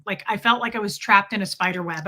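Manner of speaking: ordinary talk, nothing marked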